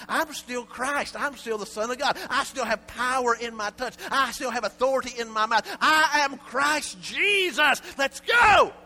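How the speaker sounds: high, loud, slow proclamation building to a shout; from a slow declaration to a triumphant shout; Jesus declaring power. Acted. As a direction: speak as Christ with full authority.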